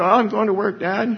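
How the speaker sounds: breezy, high mock voice of the son; carefree son. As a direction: toss it off like a son who isn't worried. Pastor imitates son leaving lightly.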